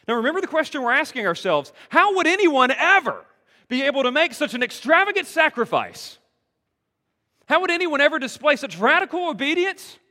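loud, fast, high-pitched preacher's call; full-force preaching. Acted. Preacher pressing the congregation with the big question, loud and fast. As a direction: drive the question home to the congregation.

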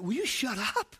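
soft exasperated aside to himself; quiet self-scolding thought; inner self-talk. Retold or imitated. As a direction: hush yourself under your breath. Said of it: "I'm talking to myself on the inside".

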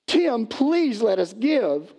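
slow, high-pitched plea; imploring, stretched-out words; begging. Acted. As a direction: draw out a hammed-up plea. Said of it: "They were begging Paul".

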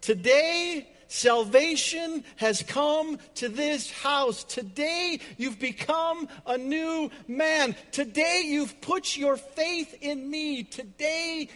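high, loud, ringing declaration; jubilant proclamation. Acted. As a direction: proclaim it like a celebration. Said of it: "Strike up the band".

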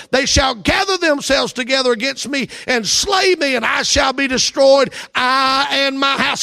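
loud, slow, soaring pulpit reading; preaching scripture at full force. Acted. Preacher reading Jacob's rebuke to the church, full-force preaching. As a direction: thunder the verse out to the congregation.

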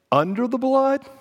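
UNDER THE BLOOD pitched high, rising at the end; rising alternative. Real, not acted. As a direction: lift "under the blood" high and leave it hanging.

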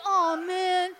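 mock a self-pitying whiner; a hammed-up moper; mopey complainer.

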